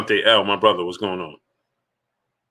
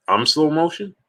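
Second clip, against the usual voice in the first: incredulous echo; 6 semitones higher than usual. Real, not acted. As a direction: repeat it back, offended and amazed. Echoing a viewer's insult back.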